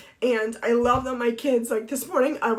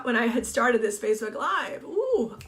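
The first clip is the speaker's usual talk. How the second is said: normal talking voice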